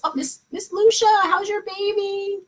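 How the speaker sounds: high, bright cooing greeting; high cooing hello; delighted greeting. Real, not acted. Greeting a parent with baby.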